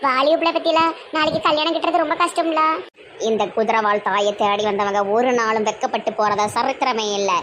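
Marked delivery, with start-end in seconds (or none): 3.3-7.3 low holy-person voice; self-important exorcist. Acted. Exorcist assuring the visitors, low self-important voice. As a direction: proclaim it low and steady.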